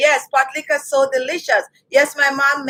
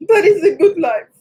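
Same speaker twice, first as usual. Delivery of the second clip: high-pitched tearful outburst; real crying, pitched way up; 6 semitones higher than usual. Real, not acted. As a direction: voice climbs high as the tears take over. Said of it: "I am not the one to cry like that".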